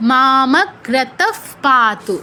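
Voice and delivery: মামগ্রতঃ পাতু very slowly, visarga as a breathy f. Shown as a demonstration. Teacher modelling for learners, slow মামগ্রতঃ ending in f sound. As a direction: draw out মামগ্রতঃ and close it on "f". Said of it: "ফয় হসন্তর মতো".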